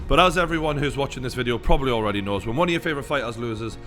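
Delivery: ordinary talk, nothing marked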